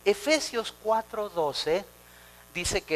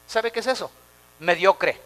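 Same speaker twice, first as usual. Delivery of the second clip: ordinary talk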